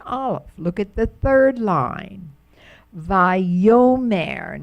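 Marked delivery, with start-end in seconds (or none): 3.0-4.6 Vayomer said with the accent on yo; vay-YO-mer, lean on the yo syllable; stress on yo. Shown as a demonstration. Teacher to Hebrew class, stressing the yo in Vayomer. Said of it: "they put the accent on the yo".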